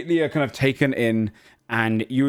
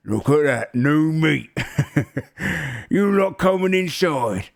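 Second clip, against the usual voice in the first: higher ogre voice with a chuckle; gloating ogre; 5 semitones higher, slower than usual. Acted. Ogre greeting new prisoners in the cell, gloating voice. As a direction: jeer at the newcomers. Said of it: "Turns around, sees you all kind of coming, goes".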